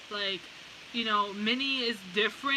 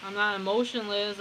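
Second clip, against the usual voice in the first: usual speaking voice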